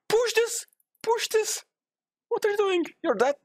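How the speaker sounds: high-pitched exasperated orders; urgent high gamer outburst; frantic yelling. Real, not acted. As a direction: yell commands at a teammate, voice shooting up.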